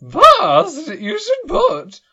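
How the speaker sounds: very high, slow fluting voice; high warbling TV cook; Julia Child. Pretend. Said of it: "Julia Child".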